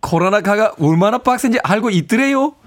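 learner's game try at Gangwon speech; Gangwon dialect attempt. Shown as a demonstration. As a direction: mimic the expert's Gangwon dialect. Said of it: "이렇게 갑니까?".

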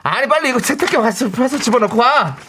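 high, exasperated scolding; nagging wife. Acted. Wife scolding husband over inside-out socks.

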